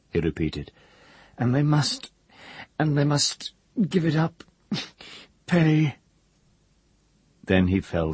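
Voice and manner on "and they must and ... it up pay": high voice with an odd laugh; a broken man cackling at a secret; crazed old wizard. A narrator's reading. Hare's voice.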